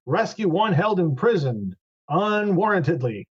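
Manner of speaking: measured declaration with long pauses; formal adventurer in character. Acted. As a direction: address the gatekeeper formally, drawing out the words.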